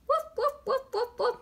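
high yappy woof like a little dog; playful high puppy barks. Shown as a demonstration. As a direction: bark high and bright for the children. Teacher voicing the dog.

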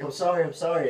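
high, loud imitation of an apologetic driver; imitated frantic apology. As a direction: lightly act out a flustered apology. Retelling frightened driver's apology.

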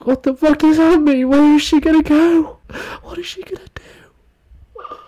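high, strained cries fading to a whisper; overacted fan distress; hammed-up shrill despair dropping to a whisper; mock panic. Mock panic over breakup.

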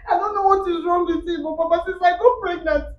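wife tearfully reports husband to his father; tearful complaint. Acted. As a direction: whimper the complaint to papa.

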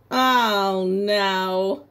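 ordinary talk

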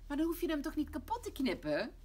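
loud, high, exasperated mother; angry scolding. Acted. Mama scolds Lotje for cutting it, angry. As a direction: tell the child off crossly. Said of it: "zegt mama boos".

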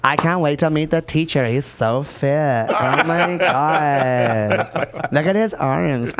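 stretched-out mock Valley girl accent; hammed-up California Valley girl drawl. Shown as a demonstration. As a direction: gush like a Valley girl, drawing out the last words. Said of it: "it's a Valley girl".

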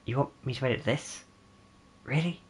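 imitated unimpressed editor. Editor handed the footage, quietly incredulous. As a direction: question it quietly, in disbelief. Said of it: "and they're gonna go".

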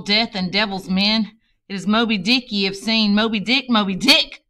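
captain's cry to his crew, building to a shout; rising shout. Acted. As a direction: build to a triumphant roar. Ahab rousing the crew.

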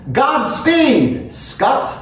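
high send-off call; brisk high-pitched farewell call; calling after someone. A narrator's reading. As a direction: call it out high, as he hurries away. Calling after departing man.

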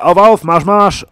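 Befehl, laut gebrüllt; shouted command. Acted. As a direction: yell them up out of cover. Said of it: "Dann schrie jemand".

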